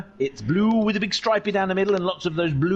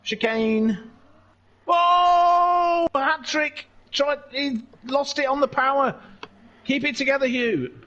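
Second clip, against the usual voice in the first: higher, lively play-by-play call; excited race commentary; 10 semitones higher than usual. Acted. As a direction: call the race action as it happens.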